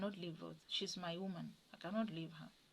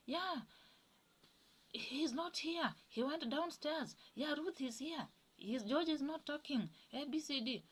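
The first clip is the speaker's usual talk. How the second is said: voicing George on the phone; 7 semitones higher than usual. George answering callers, voiced high.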